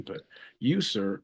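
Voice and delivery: ordinary delivery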